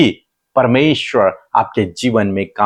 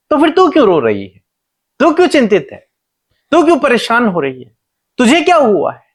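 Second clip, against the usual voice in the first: higher-pitched, insistent divine reassurance; God explaining; 8 semitones higher than usual. Retold or imitated. God explains his promise to the worried Hagar, raised insistent voice. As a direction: pitch it up and press each question home as God. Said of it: "परमेश्वर उसे समझाने की कोशिश कर रहे हैं".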